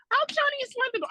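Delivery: very high voiced mom; high voiced mom asking about Linda. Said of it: "my mom says it too".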